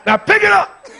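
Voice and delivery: high, very loud screamed order; screamed command. Retold or imitated. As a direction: scream it over a screaming child. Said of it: "I'm screaming right along with you".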